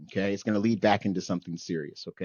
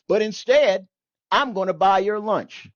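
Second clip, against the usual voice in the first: raised and higher, a grudging offer; old man's turnaround; 9 semitones higher, 7 dB louder than usual. Retold or imitated. Old man offering to buy the sailor's lunch, raised grudging voice. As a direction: switch to a loud generous offer. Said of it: "He goes".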